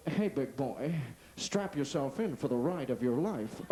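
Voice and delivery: low, slow sultry car voice; seductive car. Retold or imitated. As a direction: purr it like a flirty car. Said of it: "car that speaks to you".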